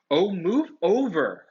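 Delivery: exasperated loud snarl. A narrator's reading. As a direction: shove them aside with your voice. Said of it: "Hermione snarled".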